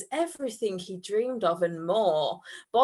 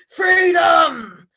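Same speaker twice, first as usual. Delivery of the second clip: loud, higher triumphant demon shout; ancient cruel demon; 8 semitones higher, 9 dB louder than usual. Acted. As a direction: roar it like a freed demon. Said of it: "spoke in a voice steeped in a millennia of cruelty".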